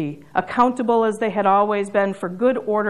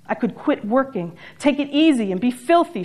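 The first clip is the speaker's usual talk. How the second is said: ordinary talk, nothing marked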